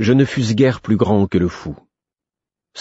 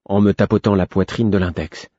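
ordinary delivery, nothing marked

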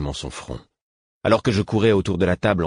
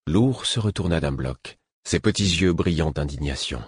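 plain reading voice

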